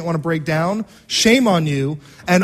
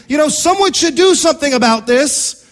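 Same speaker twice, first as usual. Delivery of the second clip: raised, louder voice of someone passing the buck; mock complaint; 7 semitones higher, 4 dB louder than usual. Voicing people who want someone else to act, complaining. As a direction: complain loudly that it's somebody else's job.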